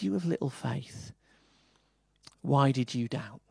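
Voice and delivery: soft, gentle rebuke. Shown as a demonstration. Pastor voicing Jesus gently to his congregation, soft and tender. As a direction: say it softly, like a loving correction. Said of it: "I think it's a gentle voice".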